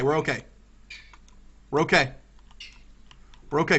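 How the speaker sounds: usual speaking voice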